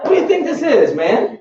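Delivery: high, exasperated comeback to the boss; mock angry retort. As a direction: fire it back, half laughing, fed up.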